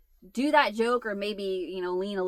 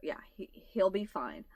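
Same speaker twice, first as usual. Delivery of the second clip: mock whisper; 7 dB quieter than usual. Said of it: "Matt was like".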